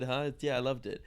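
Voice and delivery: ordinary talk, nothing marked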